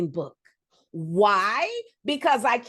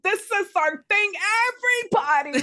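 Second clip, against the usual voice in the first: high-pitched excited proclamation; excited announcing; 8 semitones higher, 3 dB louder, faster than usual. Acted. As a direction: announce it to everyone, high and thrilled. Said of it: "I am so fucking excited".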